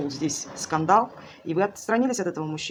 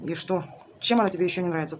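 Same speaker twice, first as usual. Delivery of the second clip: ordinary talk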